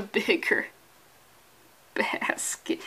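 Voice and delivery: soft unvoiced whisper; whispered punchline. Host whispering the punchline to his audience, hushed.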